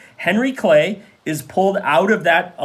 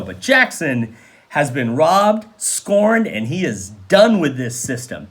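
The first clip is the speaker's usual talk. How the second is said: usual speaking voice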